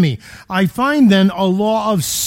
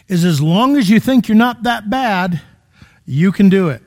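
normal talking voice

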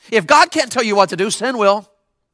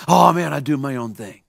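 imitating laid-back kid; 8 semitones lower, 4 dB quieter than usual. Mohawked kid's answer repeated to congregation, low drawling imitation. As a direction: let it sink low and lazy. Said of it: "That's what he said".

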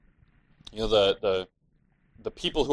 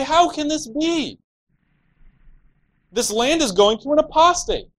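much higher and louder, an indignant mocking cry; mock outrage; 6 dB louder than usual. Preacher mocking the land robbers, shrill indignant cry.

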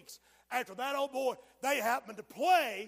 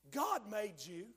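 ordinary talk, nothing marked

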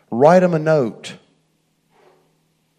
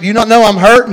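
higher, louder hurt plea; hurt protest; 8 semitones higher, 8 dB louder, faster than usual. Retold or imitated. Young worker pleading with his boss, higher and louder. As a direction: cry out that you're hurting. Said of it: "was like".

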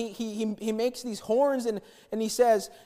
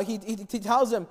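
usual speaking voice

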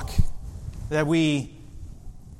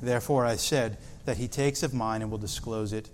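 softer, measured Bible reading voice; scripture reading; 5 semitones lower, 6 dB quieter than usual. Acted. Pastor reading the Gospel to the congregation, soft and measured. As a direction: read the verses with quiet reverence.

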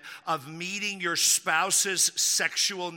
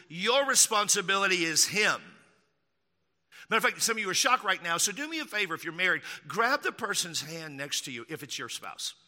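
normal talking voice

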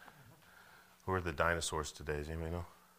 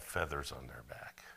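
sudden whisper; 6 semitones lower, 8 dB quieter than usual. Real, not acted. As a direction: drop to a whisper on the question. Preacher drops voice mid-question.

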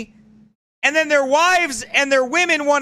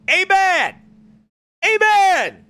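higher, louder fervent amen; emphatic amen; 7 semitones higher, 3 dB louder, slower than usual. Real, not acted. Preacher affirming the clip with a loud amen. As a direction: call out agreement like a congregation.

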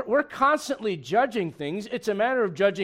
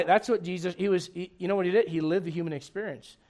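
ordinary talk

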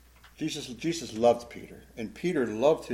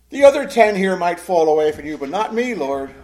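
loud, boastful assurance; hint of pride; 5 semitones higher, 11 dB louder than usual. Peter comparing himself to the other ten, proud boast. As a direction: set yourself proudly above the others. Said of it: "hear the hint of pride in that?".

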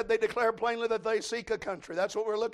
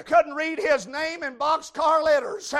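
loud revival preaching, rising and ringing; preaching at full force; 7 semitones higher, 11 dB louder than usual. Acted. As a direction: preach it hard to the congregation. Preacher shouting the sermon.

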